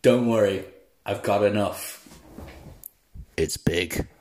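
low, deep seductive line. Acted. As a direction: drop the voice low and suggestive. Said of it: "Say that line to me there".

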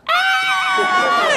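very high, sustained mock shriek; panicked scream. Teens seeing a man with a knife, high panicked scream. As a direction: shriek like a terrified kid. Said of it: "we're freaking out".

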